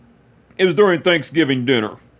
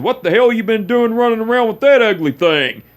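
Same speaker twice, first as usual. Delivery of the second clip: put-on raised, teasing old-man jab; mock-insulting uncle; 8 semitones higher, 3 dB louder than usual. Uncle teasing the nephew's girlfriend, loud mock scorn. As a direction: rib the boyfriend with a loud grin.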